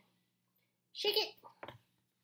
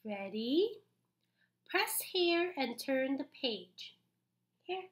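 plain reading voice

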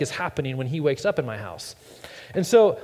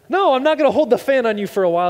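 exasperated refusal; 7 semitones higher, 6 dB louder, faster than usual. Retold or imitated. Dad refusing son.

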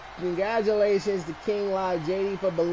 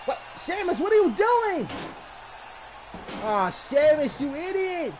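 exasperated yell; 7 semitones higher than usual. Real, not acted. Scolding the wrestler on screen.

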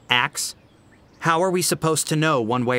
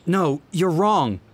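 pleading; 6 semitones higher, 4 dB louder than usual. A narrator's reading. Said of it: "my voice pleaded".

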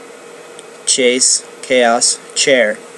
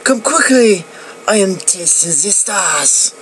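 higher, eager call to the brothers; excited monk; 7 semitones higher than usual. Acted. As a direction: call out in delight, lightly acted. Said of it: "He called out to his fellow monks".

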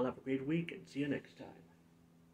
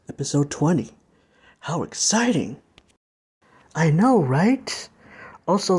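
hyped announcer; 19 dB louder than usual. Acted. YouTuber hyping his own blooper reel and Disney compilation, loud announcer. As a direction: sell the blooper reel like a trailer voice.

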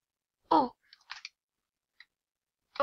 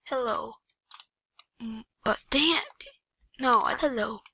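ordinary delivery

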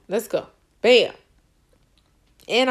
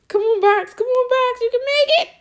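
high, loud urging; root for the box with everything; excited cheering; 12 semitones higher, 5 dB louder than usual. Real, not acted. Gamer urging her box across, high and loud.

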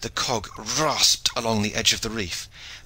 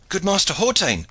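high, raised hailing call; urgent cry; 10 semitones higher, 4 dB louder than usual. A narrator's reading. Boatswain rushing aft to the master, crying out. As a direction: hail the master in alarm. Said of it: "cried the boatswain".

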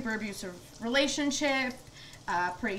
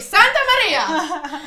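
loud, slow, laughing cry of Santa Maria; excited shout; 7 semitones higher, 11 dB louder, slower than usual. Real, not acted. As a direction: belt it out while laughing. Throwing pasta at the wall.

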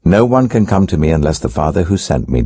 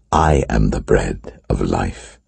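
slow solemn declaration; 7 semitones lower, 3 dB quieter, slower than usual. Acted. Jesus solemnly declares himself.

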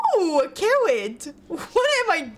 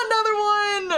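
laughing shout; 6 semitones higher than usual. Real, not acted. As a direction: shout through a laugh, high. Disgusted, laughing at items.

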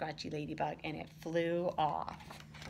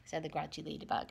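ordinary delivery

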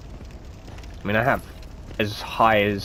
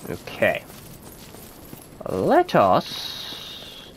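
ordinary delivery

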